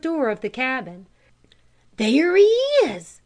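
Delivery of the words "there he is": high, loud joyful cry; excited cry. A narrator's reading. Tom spotting the lost dog running toward them, loud excited cry. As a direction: shout it as the dog bounds up. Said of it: "cried Tom".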